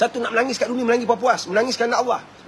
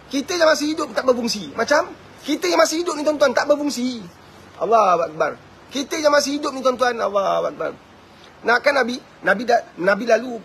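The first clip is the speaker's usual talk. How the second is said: raised-pitch preaching push; fervent preaching; 4 semitones higher than usual. Acted. Preacher stirring his listeners, fervent raised voice. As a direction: lift the voice, urge the congregation.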